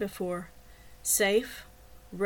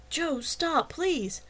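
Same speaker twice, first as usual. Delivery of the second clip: higher-pitched, anxious plea; urgent pleading; 7 semitones higher, much slower than usual. A narrator's reading. Woman begging her man to stop choking someone, pleading. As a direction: beg him to let go.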